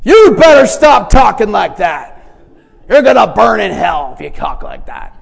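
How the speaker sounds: loud mocking sermon at a swearing coworker; mock fire-and-brimstone preacher. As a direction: ham up the self-righteous street preacher.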